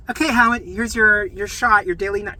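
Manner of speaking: high, loud imitation of a nurse; rousing nurse. Nurse waking a patient for his shot, rousing. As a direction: announce it loudly to someone asleep.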